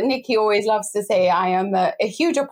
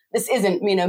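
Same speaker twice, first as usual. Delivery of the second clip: normal talking voice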